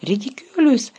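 high shout of the spell. Acted. Parvati facing the mummy, shouting the spell. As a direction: she yells the spell at the mummy. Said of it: "крикнула Парваті".

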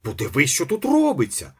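panicked, pointing at the scene; scared engineer shouting to his wife; frightened shout. A narrator's reading. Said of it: "закричав переляканий інженер".

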